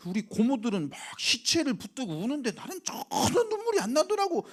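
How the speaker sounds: normal talking voice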